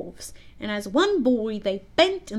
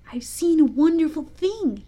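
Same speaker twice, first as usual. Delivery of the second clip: higher-pitched wonder; excited cry; 6 semitones higher than usual. A narrator's reading. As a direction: announce a marvel to the others. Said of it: "he cried".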